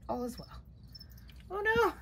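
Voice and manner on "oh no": sudden high loud cry; alarmed. Real, not acted. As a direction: yelp it in alarm. Spider about to escape.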